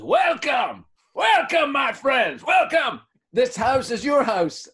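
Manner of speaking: big booming welcome, high and loud; booming sing song welcome. Retold or imitated. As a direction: welcome them like a grand host. Host greeting them at his gates.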